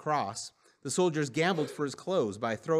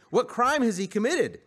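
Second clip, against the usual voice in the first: higher-pitched challenge to the crowd; demanding; 8 semitones higher, 4 dB louder than usual. A narrator's reading. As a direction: press them for the charge. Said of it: "Pilate demanded".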